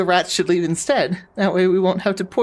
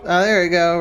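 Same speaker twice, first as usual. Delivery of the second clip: ordinary talk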